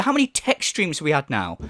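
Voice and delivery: usual speaking voice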